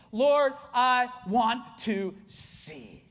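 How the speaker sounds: very slow, word by word; weighty, drawn-out plea; heartfelt plea. Acted. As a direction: draw out each word of the prayer. Acting the blind man's plea.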